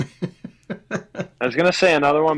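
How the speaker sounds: slow, with a laugh in the words. Real, not acted. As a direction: chuckle through it as you change your mind. Laughing while changing his pick.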